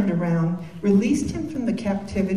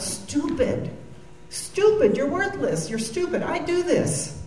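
yelling at herself; 7 semitones higher, slower than usual. Retold or imitated. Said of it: "times when I'm yelling out".

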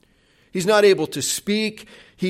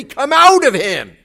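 loud, higher commanding order; louder, more authority; 8 semitones higher, 8 dB louder than usual. Retold or imitated. Disciples order the demon again, louder and more commanding. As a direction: demand it with full authority. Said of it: "they say it louder and with more authority".

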